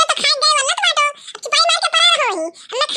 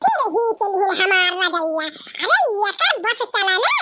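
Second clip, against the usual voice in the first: ordinary delivery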